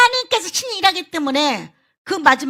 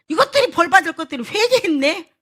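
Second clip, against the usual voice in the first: louder, higher, scornful question; a sulking prophet's outrage; indignant Jonah; 7 semitones higher, 6 dB louder than usual. Acted. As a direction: Jonah fuming that sinners repented, louder and higher. Angry Jonah voiced.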